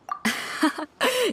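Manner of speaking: a short high amused laugh; amused chuckle. Acted. Host chuckling at the confident traveler, amused laugh. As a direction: laugh lightly at their confidence.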